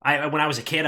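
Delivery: ordinary talk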